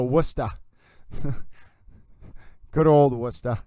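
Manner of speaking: Worcester as "Wustah", Boston-style; playful Boston accent. Pretend. Streamer riffing on local accents, playful Boston accent. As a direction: lean into the Boston R-dropping.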